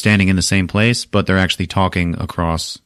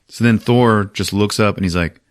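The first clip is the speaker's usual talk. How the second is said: plain reading voice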